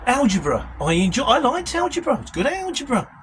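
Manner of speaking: ordinary delivery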